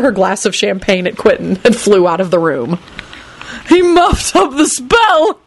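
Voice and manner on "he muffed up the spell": high, slow shout through laughter; wheezing laugh. A narrator's reading. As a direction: howl it out gasping. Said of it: "George wheezed".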